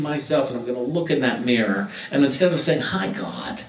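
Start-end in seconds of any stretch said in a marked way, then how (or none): 2.8-3.6 hushed, breathy hello; whispered greeting. Pretend. Greeting yourself in the mirror, whispered.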